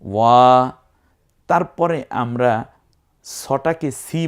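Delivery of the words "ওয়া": ওয়া louder and held long; long vowel. Shown as a demonstration. Teacher showing empty alif, loud elongated ওয়া. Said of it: "জবরের পরে খালি আলিফ".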